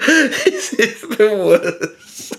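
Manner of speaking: high, drawn-out words through laughter; helpless laughter. Real, not acted. Comedian cracking up at awful lyrics, helpless laughter. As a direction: barely get it out for laughing. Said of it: "I'm just laughing at it".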